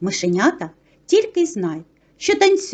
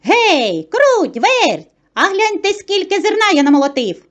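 high, raised calling voice; 8 semitones higher, 4 dB louder than usual. A narrator's reading. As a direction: call the mice over to admire it. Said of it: "та й знов гукає".

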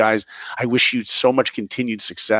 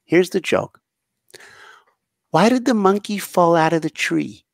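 put-on high, sweet little girl voice; little girl telling her joke into the mic; 7 semitones higher than usual. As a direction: tell it like a proud five-year-old. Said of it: "And she goes".

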